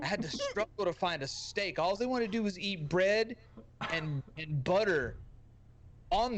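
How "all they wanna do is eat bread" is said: ordinary talk, nothing marked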